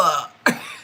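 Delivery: brief real chuckle; quick laugh; short laugh. Real, not acted. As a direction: laugh at her own joke. Laughing after her joke.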